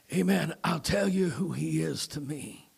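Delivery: usual speaking voice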